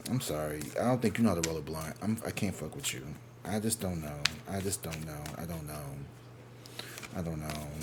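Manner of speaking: low, softened retold let-down; resigned rejection. Turning down a nervous date, quietly resigned. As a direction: back away quietly, voice sinking.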